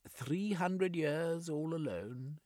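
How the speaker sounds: slow, high, strange ancient voice; drawn out like centuries of solitude; Sigurd's lonely lament. A narrator's reading. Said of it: "His accent was curious".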